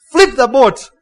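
loud, high order, cutting in; shouted interjection. Retold or imitated. Wife cut in on husband, imitated shouted order. Wife cuts in before he speaks.

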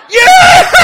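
very high, loud shriek of laughter; shriek-laugh like someone yanking an arm; imitated puller laugher. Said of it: "You have any puller laughers here?".

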